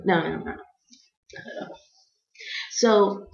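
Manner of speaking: ordinary talk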